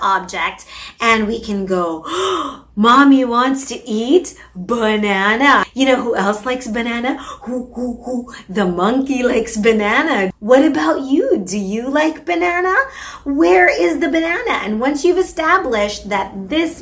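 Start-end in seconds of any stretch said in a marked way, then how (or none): 2.1-2.6 surprised gasp. Shown as a demonstration. Demo to toddler. 2.8-14.6 playful parentese. Shown as a demonstration. Demo talk to toddler.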